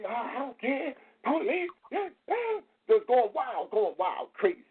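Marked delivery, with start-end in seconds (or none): 0.0-2.7 high, mocking rant voice; mock ranting man. Retelling the video, he mimics the man ranting at police in a high voice.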